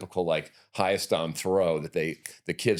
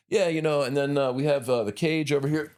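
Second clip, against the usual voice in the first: higher, louder casual gym-tour voice; buddy giving a tour; 9 semitones higher, 3 dB louder than usual. Retold or imitated. Friend showing the gym while kicking a kid, breezy and high. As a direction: show off the gym breezily, pitched up. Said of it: "And he's like".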